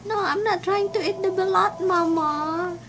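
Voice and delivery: high, put-on kid voice protesting to Mama; mock child reply. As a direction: squeak it back like an offended child. Mock reply to mother figure.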